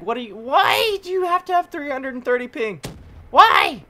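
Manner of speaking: high, loud pleading complaint; complaining at a player with lag; exasperated whine. Real, not acted. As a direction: wail at the laggy player. Raging at laggy opponent.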